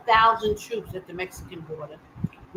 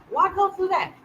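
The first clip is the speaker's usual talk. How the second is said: pleading higher; 10 semitones higher than usual. Real, not acted. To brothers and sisters, pleading high-pitched question.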